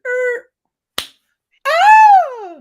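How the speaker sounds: very high, loud imitated woman's scream; mock scream of a woman outside. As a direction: shriek like a woman hit outside. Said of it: "we just heard".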